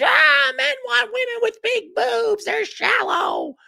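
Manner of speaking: high mocking yell, slow at the end; mocking feminist shout. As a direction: shriek it like an outraged caricature. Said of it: "you could be like all the feminists".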